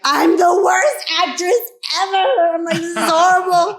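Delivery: high, loud, slow wail of self-pity; half-laughing, half-crying lament; hammed-up despairing actress; mock despair. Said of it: "I was like".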